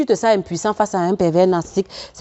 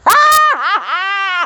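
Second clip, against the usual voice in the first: high, drawn-out triumphant laugh; gleeful cackle; 12 semitones higher, 3 dB louder than usual. Real, not acted. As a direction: cackle in long high bursts. Gleeful laugh at weakened adversary. (grand rire aigu et triomphant)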